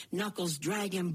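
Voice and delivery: usual speaking voice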